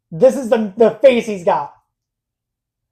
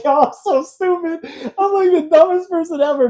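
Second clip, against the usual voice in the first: high, laughing self-mockery, a bit louder; laughing at himself; 10 semitones higher, 3 dB louder than usual. Man retelling his work blunder, laughing at himself. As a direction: crack up at your own mistake. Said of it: "it made me laugh so much".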